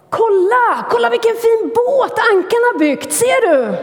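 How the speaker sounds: very high, loud, eager shout; excited call. Acted. Swan calls hedgehog to admire boat, excited high shout. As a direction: show off the friend's boat. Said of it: "ropade ankan och sa".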